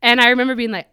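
ordinary talk

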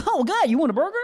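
high, suddenly lively offer; mock perky recovery. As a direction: burst out high and chipper. Acts snapping back to normal afterwards.

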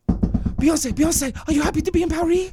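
put-on very high, squeaky French accent; fast, high-pitched mock French accent. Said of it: "In a French accent".

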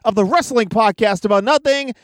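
high, upbeat podcast intro read; announcer voice. Acted. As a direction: sell the show like a host.

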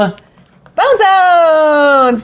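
high, drawn-out announcer call on the name; hyped sponsor shout. Pretend. Co-host jumps into the website commercial, hyped announcer shout. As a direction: belt out the brand, stretching it long.

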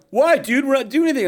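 put-on high, protesting teen dude; teenager. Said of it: "I see ten teenagers sitting".

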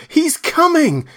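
high, loud panicked cries for help; desperate high screaming; terrified shrieking. Acted. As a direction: shriek for help, fleeing a killer. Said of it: "his voice shrieking with terror".

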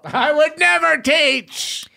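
high, drawn-out hammed-up indignation; mock outrage. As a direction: wail it in pretend offence. Mock outrage at teaching improv joke.

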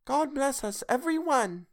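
put-on high, small voice of a sickly child; Tiny Tim. A narrator's reading. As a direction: pipe it up like a frail little boy. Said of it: "said Tiny Tim".

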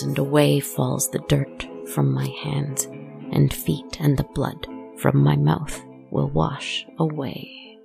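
haunting first-person storytelling; eerie narration. A narrator's reading. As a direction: tell it like a ghost story by the fire. First-person horror monologue over music.

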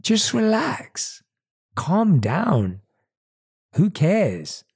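slow, soothing lure of the lazy inner voice; tempting inner voice. Retold or imitated. Speaker voicing the voice in his head talking him out of it, slow soothing coax. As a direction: coax yourself into giving up, slowly. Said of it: "The voice says".

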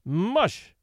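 mild, contained shout; shouted command. A narrator's reading. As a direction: call out a sled-dog command. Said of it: "he shouted".

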